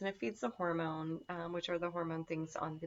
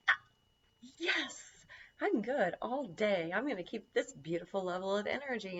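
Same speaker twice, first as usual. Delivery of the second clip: contented relief; 3 semitones higher than usual. Acted. Voices chaga's contented steady energy.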